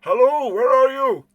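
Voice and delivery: loud, high searching call. Acted. Man runs round the lake seeking someone, calling out. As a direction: holler into the distance for someone missing. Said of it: "You hear him calling out".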